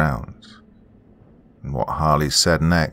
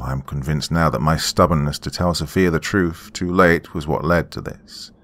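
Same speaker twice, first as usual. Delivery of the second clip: plain reading voice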